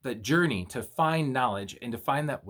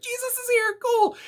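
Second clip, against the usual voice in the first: far higher, squeaky amazed voice; cartoonish high-pitched excitement; giddy apostles; 4 dB louder than usual. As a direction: gush like starstruck fans. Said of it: "and they're like".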